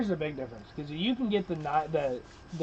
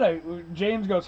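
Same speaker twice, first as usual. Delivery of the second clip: normal talking voice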